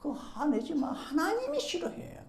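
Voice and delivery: lightly voiced, soft high admonition; chiding church deacon. Deacon to an angry church member, soft high chiding. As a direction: imitate a pious bystander gently scolding. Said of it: "옆에 집사님이".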